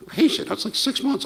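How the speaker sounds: disbelieving yelp at the word; incredulous echo. Retold or imitated. Incredulous new guy echoing boss.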